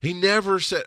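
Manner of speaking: usual speaking voice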